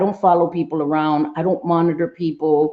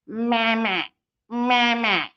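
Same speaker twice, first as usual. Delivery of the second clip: mock "mama" like a creepy old doll; imitated doll voice on "mama"; talking doll; 2 semitones higher, 5 dB quieter, much slower than usual. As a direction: say "mama" as a mechanical doll would. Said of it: "old school dolls that would say mama".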